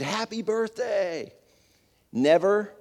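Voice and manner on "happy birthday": higher, drawn-out surprise cheer; a slow, mock-shouted happy birthday; crowd's surprise cheer, lightly done; screamed greeting. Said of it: "we all screamed".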